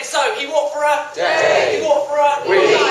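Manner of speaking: rally the audience; loud excited narration. A narrator's reading. Excited storytelling climax.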